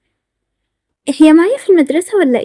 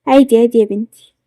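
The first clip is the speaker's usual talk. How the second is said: lower, lightly voiced mother; reassuring mother; 6 semitones lower than usual. Mother answers her daughter's apology, lightly acted reassurance.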